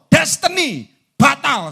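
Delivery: high, loud, drawn-out words; thundering warning. Real, not acted. Preacher warning destiny is cancelled, loud and slow. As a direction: cry it out slowly from the pulpit.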